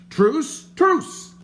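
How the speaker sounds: loud, very high panicked cry for truce; frantic calling out. A narrator's reading. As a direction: yell it out to stop the sword. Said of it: "called the stranger".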